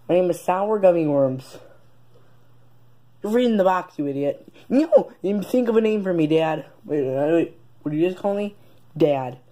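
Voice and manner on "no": high loud no; indignant shout. Acted. Kid denying he read the box, high indignant shout. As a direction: snap back high and loud.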